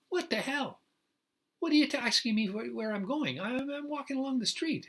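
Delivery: high-pitched, incredulous protest; acted outrage at being questioned; indignant retort. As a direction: argue back at an officer, voice jumping high.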